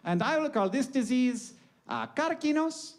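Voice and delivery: high, deliberate put-on accent; comic foreign-accented proclamation; Hippocrates. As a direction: pronounce it grandly as an ancient physician. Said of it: "in a separate document he wrote down".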